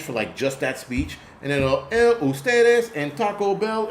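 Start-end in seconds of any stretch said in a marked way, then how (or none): 1.9-3.9 mock bad Spanish. Mocking teacher's bad Spanish.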